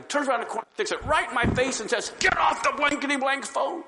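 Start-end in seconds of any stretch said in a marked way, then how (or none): 2.2-3.8 gruff mock yell at the phone; a retold robber's threat. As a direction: bark it like a gunman.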